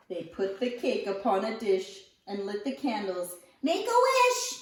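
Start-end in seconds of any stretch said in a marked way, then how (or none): 3.6-4.6 high, eager call; bright high shout of a wish; children crying out. Acted. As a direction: sing it out like excited kids. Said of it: "the children cried".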